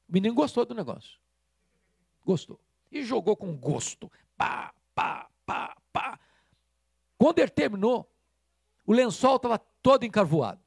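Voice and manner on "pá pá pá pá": thrown coal thuds. Retold or imitated. Imitating coal thrown at sheet.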